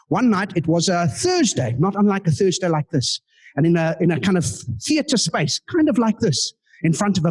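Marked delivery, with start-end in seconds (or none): none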